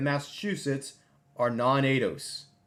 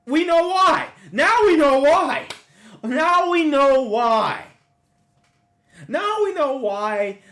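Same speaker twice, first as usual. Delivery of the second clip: much higher and louder, told-you-so; triumphant realization; 13 semitones higher, 12 dB louder, slower than usual. Real, not acted. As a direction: crow it, high and loud. Reacting to Boston numbers.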